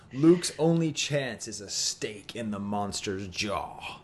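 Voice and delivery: put-on slow, low British announcer accent; stately British toy-commercial voiceover; mock British announcer. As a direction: savour it slowly as a British ad narrator. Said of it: "So it's that line".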